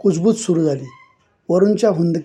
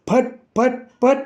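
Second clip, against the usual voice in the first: high sharp imitated slaps; smacking sound effect; 6 semitones higher than usual. A narrator's reading. Teacher beats the pupil, imitated smacks. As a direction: voice each blow.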